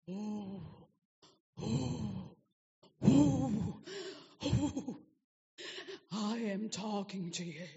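slow, drawn-out mock voice of God; ghostly wailing oohs, then ponderous, stretched-out words; spooky God voice. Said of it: "doesn't talk like that".

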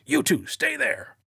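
high, slow shouted order; commanding cop. Retold or imitated. Suspicious cop orders two men by the hearse, commanding. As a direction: call out a firm police command.

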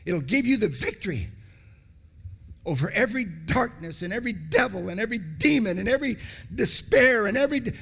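fiery preaching; preaching at full force. Acted. Preacher driving the point home.

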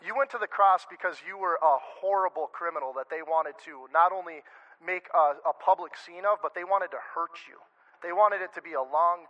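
ordinary delivery

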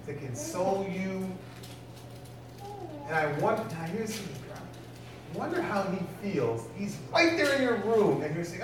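ordinary talk, nothing marked

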